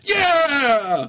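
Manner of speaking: long, high triumphant yeah; cheering. Pretend. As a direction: whoop it out like a keen supporter. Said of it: "cheering you on every time".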